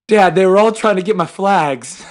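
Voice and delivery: put-on half-hearted kid whine; lightly voiced aggrieved little boy; son's voice. Father retelling son's complaint.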